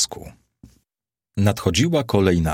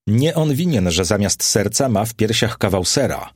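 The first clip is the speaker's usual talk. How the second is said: ordinary delivery, nothing marked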